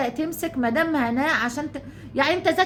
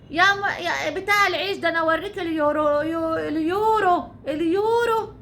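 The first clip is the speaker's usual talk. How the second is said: raised, jeering imitation of the woman; mocking her taunt; 3 semitones higher than usual. Imitating the woman taunting the bread man, jeering. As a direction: sneer it back like the woman taunting him. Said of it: "بتقول لك ايه".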